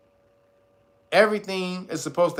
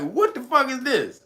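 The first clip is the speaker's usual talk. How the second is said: baffled; 7 semitones higher than usual. Retold or imitated. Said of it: "He was like".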